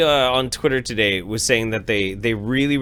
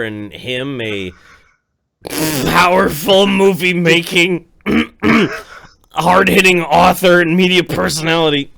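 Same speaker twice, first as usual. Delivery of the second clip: booming, louder dramatic trailer voice on the powerful author; mock romance narration; 5 dB louder than usual. Playing himself as a virgin flower for his cohost, in a hammed-up dramatic voice. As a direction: ham it up like a trashy novel blurb.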